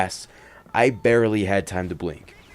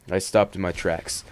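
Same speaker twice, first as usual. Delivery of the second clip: ordinary delivery, nothing marked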